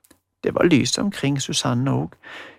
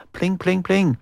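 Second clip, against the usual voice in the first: higher sung pling pling like plucked strings; plinking guitar imitation; mock guitar plinks; 4 semitones higher than usual. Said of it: "han klimpra vakkert på gitaren".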